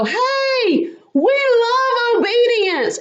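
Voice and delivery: high, drawn-out mock holler; imitated holler. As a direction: call it after someone running away. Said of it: "hollered after me".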